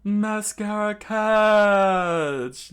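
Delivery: loud, high, very drawn-out cry; theatrical battle cry; attack call. Acted. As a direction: stretch the move name with flourish. Villain calls out attack name.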